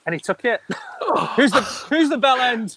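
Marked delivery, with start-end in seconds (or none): 0.7-1.3 a sudden high cackle of triumph; high burst of laughter. Real, not acted. Host laughing at how he got the Costa cheap. Laughs at his own luck. 1.4-2.8 slow, higher, laughing gloat; gloating punchline. Real, not acted. Owner telling host who was really the bell end, gloating.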